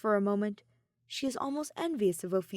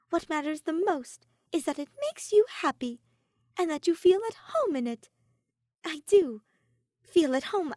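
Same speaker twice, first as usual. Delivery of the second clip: much higher, louder, reassuring voice; reassuring Ophelia; 8 semitones higher, 5 dB louder than usual. A narrator's reading. Ophelia reassuring Soleil about her coat, high and louder. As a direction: lift her up with every word. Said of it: "Ophelia smiles reassuringly".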